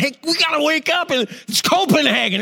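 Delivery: high, fast, eager rousing; husband waking pregnant wife with big news; excited wake-up. Retold or imitated. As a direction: shake the sleeping wife awake with news.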